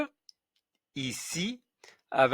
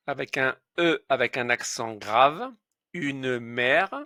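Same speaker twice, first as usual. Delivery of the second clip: normal talking voice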